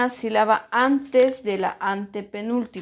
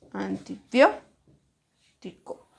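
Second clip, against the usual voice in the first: "Antibiótico" slowly as antiBIÓ... tico; stressed syllable; much slower than usual. Shown as a demonstration. Teacher sounding out "Antibiótico" to find its stress, slow and broken. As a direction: punch BIÓ in "Antibiótico", pause, then tico. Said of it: "¿Dónde tiene la mayor fuerza de voz?".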